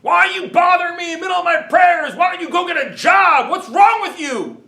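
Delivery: put-on high, shouted scolding of the kids, slowing at the end; imitating a man shouting in the middle of his prayers; retold angry yell. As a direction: bark it at the boys like an outraged stranger. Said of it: "And he's yelling at these kids".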